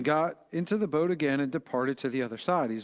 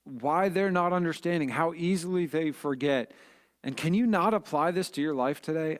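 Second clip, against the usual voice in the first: normal talking voice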